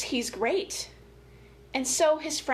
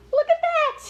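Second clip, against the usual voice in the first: high, louder gasp of surprise; delighted gasp; 4 dB louder than usual. Acted. Showing children Billy playing fairly, a delighted surprised gasp. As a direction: gasp with delight, then point it out.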